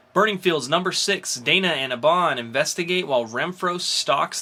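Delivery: bright comic-show announcer listing new releases; announcer read. Acted. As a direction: sell each title like a podcast host. Hosts upcoming comics rundown.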